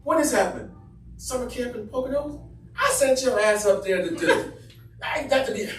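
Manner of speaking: high, loud ranting mom; mother ranting. Retold or imitated. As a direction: rant like a furious mother.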